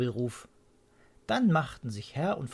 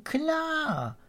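eureka cry. A narrator's reading. Mr Swallow realises it is blossom, high eureka cry. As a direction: burst out with the realisation. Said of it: "rief Herr Schwalbe dann".

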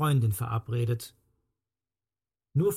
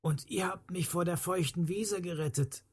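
soft, half-whispered, raised pitch; hushed gratitude; 5 semitones higher, 6 dB quieter than usual. A narrator's reading. Rescued man thanking his young helpers, hushed. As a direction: confide it softly as thanks.

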